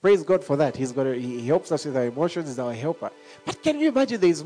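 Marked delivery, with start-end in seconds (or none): none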